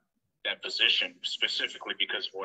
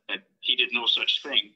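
normal talking voice

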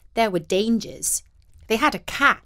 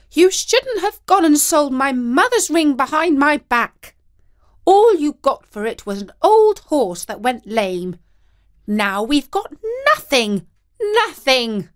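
angry wife; 6 semitones higher, 6 dB louder than usual. A narrator's reading. Wife shouting at husband.

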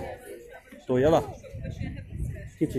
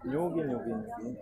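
soothing hush; 12 dB quieter than usual. Real, not acted. Father soothing restless child.

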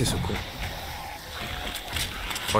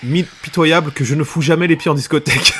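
normal talking voice

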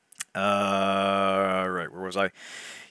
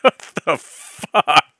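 baffled; 5 semitones higher, 5 dB louder, much slower than usual. Real, not acted. Reacting to the long paragraph.

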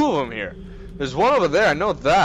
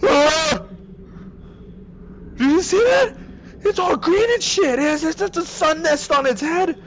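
scared yelling; 10 semitones higher than usual. Real, not acted. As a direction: yelp it, freaked out. Monster appears, high yelling.

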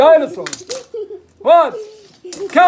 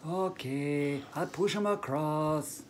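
much lower, much softer puppet fox; 9 semitones lower, 15 dB quieter, slower than usual. Acted. Fox answers Bear about his accent, low and soft. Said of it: "Fox, I think you should".

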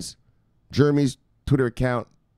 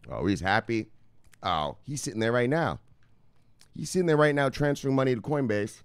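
normal talking voice

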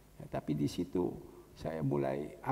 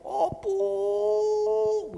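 high, loud drawn-out cry of grief; mock wailing shout; 9 dB louder than usual. Imitating a Batak mourner's loud cry in the group chat. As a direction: shout the name like a mourner breaking down. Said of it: "Ada orang Batak kan suka teriak-teriak".

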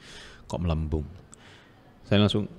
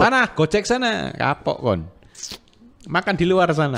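mock wife telling off; 7 semitones higher than usual.

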